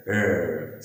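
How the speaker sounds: dog-like American 'rrr'; American growled R. Shown as a demonstration. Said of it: "dog जब angry होते हैं".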